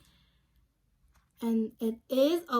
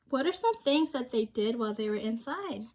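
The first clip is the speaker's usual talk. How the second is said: ordinary delivery, nothing marked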